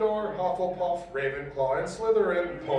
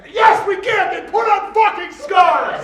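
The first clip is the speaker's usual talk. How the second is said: high, loud mock rally cheer; 9 semitones higher, 6 dB louder than usual. Reading fanfic to friends, a loud hammed-up cheer. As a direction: cheer it like a crowd chanting.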